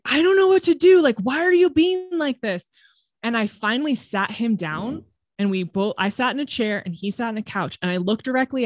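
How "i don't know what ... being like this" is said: high, loud imitation of a frantic yell; angry yelling. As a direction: mimic an exasperated man yelling. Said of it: "getting angry and yelling".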